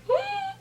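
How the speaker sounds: high, soft closed-mouth "hmm"; nervous squeak. Retold or imitated. Woman told she is not leaving, nervous squeak. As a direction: squeak quietly through closed lips. Acts out nervous reaction to blocked door.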